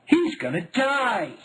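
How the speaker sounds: put-on high, slow childlike wail of alarm; child fearing the duck will drown; panicked child. A narrator's reading. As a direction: cry out in fear for the pet. Kids panicking over duckling.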